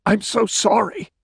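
high, anguished wail. A narrator's reading. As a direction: cry it out in despair. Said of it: "he suddenly wailed".

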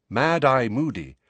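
plain reading voice

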